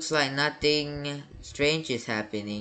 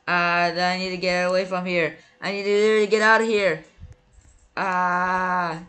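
frantic fleeing cries; 6 semitones higher, 5 dB louder than usual. Real, not acted. Fleeing the chasing ghost.

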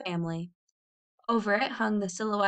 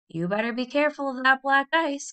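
mildly raised, higher calling voice; a touch of calling out, pitched up; 6 semitones higher, 3 dB louder than usual. A narrator's reading. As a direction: call out a warning, without shouting. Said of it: "called Tom".

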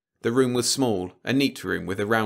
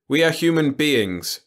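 raised, higher voice with a sharp edge; 8 semitones higher, 7 dB louder than usual. A narrator's reading. Franks protests to the machine leader, sharply. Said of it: "Franks said sharply".

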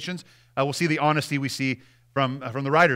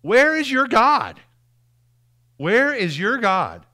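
mocking taunt; 7 semitones higher, 5 dB louder, slower than usual. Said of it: "people say to me".

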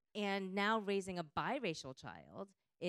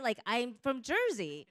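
louder, higher, proud declaration; emphatic Jersey claim; 5 semitones higher, 7 dB louder than usual. Real, not acted. Panelist repeating her origin to the audience, loud and proud. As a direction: plant your flag on Jersey.

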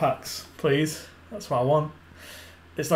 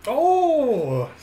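surprised; 10 semitones higher, 5 dB louder than usual. Real, not acted. Surprised at points.

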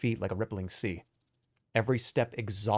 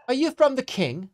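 higher, suspicious innkeeper woman; demanding innkeeper; 11 semitones higher than usual. Acted. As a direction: demand it from the doorway. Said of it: "she demands".

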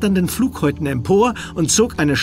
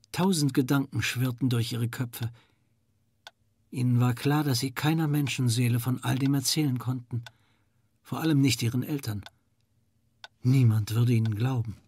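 hushed; 6 dB quieter than usual. A narrator's reading. Narrator, children sit silent.

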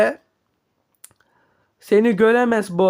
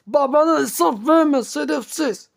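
high angry yell at his son; furious high father's scolding; 6 semitones higher than usual. Acted. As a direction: bark it at the disobedient robot. Father yells at the robot son.

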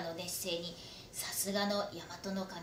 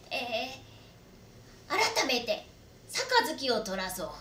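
genial lord; 4 semitones higher, 4 dB louder than usual. Acted. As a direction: lordly voice turning cheerful. Lord cheerfully calls for sake.

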